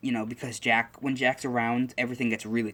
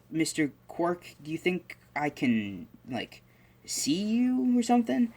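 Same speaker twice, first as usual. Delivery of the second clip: slow and higher, rising to a hopeful squeak; young Izuku, hopeful; 7 semitones higher, slower than usual. Retold or imitated. Izuku asks the quirk if he can see it, slow and hopeful. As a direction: ask shyly whether he might see it.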